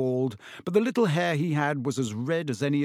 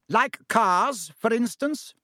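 pointed; 8 semitones higher, 4 dB louder, slower than usual. Acted. Wife confronts husband.

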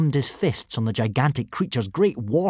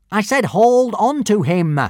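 higher, louder angry command; cross shout; 9 semitones higher, 7 dB louder than usual. A narrator's reading. Prefect yells crossly at the gronkel that won't move, cross shout. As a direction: yell at the disobedient dragon. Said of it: "shouted the thin prefect crossly".

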